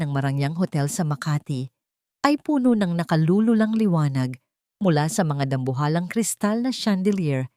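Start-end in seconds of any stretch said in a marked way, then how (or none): none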